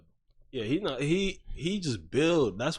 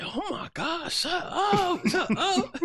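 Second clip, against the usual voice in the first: very high-pitched mock outburst; imitated tweaking out; much slower than usual. Retelling how Kenny snapped at an annoying player, high mock freak-out. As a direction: imitate a kid losing it, pitched way up. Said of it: "And then he tweaked on him".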